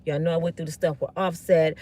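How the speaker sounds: low, soft, subdued appeal; humble plea. Acted. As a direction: speak gently and low, asking for patience. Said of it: "This is what I would have said if I was her".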